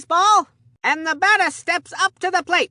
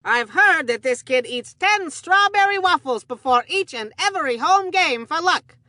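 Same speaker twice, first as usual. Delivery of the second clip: normal talking voice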